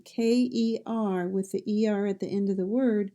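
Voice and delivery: ordinary talk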